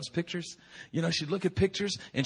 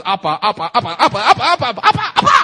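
put-on baby babbling appa; 6 semitones higher, 16 dB louder, much faster than usual. Imitating baby repeating appa.

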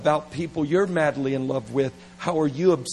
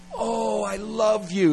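put-on gushing young lover; 7 semitones higher, slower than usual. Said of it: "when you as a twenty-year-old go".

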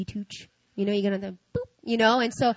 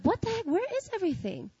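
high, baffled cry of disbelief; mock outrage, much higher; shocked; 11 semitones higher than usual. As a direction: squeak it out, totally thrown. Shocked at missing clothes in dream.

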